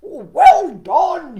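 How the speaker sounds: a big frog cheering loudly. Acted. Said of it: "he cheered".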